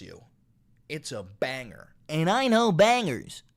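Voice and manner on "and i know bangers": loud, higher cocky boast; bragging. Real, not acted. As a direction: brag it proudly. Bragging punchline.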